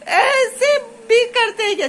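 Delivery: high, drawn-out laughing disbelief; amused exasperation. Real, not acted. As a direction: protest through helpless laughter. Amused exasperation at daughter's argument. (हँसी भरी झुंझलाहट)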